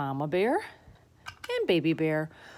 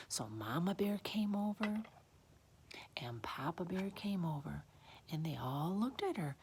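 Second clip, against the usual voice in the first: soft storytelling whisper; hushed narration; 10 dB quieter than usual. A narrator's reading. Bears creeping over to the sleeper, hushed narration. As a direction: tiptoe through it, very softly.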